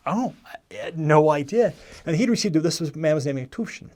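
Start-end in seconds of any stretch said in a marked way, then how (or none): 0.1-1.7 drawn-out, louder, higher reaction of surprise; retold surprise. Missionary learning the stranger is already baptized, surprised. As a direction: replay your astonishment, slow and raised.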